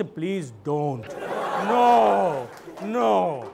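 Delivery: hammed-up private pleading; silent inner plea. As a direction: beg inwardly, as if dreading what comes. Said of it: "মনে মনে বলছে".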